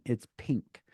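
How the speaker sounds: ordinary talk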